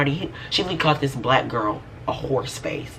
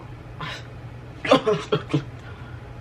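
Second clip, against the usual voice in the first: high breathy giggle; high airy laugh; 9 semitones higher, 4 dB quieter than usual. Real, not acted. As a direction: let out a light airy laugh. Laughs after brushing her off.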